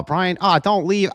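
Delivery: high, whiny plea; mock whine. Teasing a leaving viewer, high whiny plea. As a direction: whine at him to stay.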